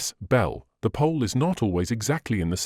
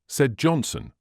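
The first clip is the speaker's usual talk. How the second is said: plain reading voice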